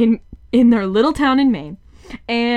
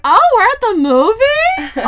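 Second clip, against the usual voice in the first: put-on high, slow, dazed little kid voice; imitating the kid waking up in the movies, high and slow; kid just waking up; 5 dB louder, slower than usual. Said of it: "being like".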